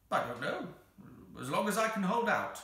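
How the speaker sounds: high creature voice, rising in effort; reluctant creature. Acted. The Psammead says how many wishes, reluctant. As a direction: answer as the strained little creature.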